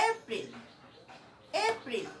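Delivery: April with a small lean on এ; stress on April. Shown as a demonstration. Teacher models April with the stress. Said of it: "এটা কিন্তু একটা stress".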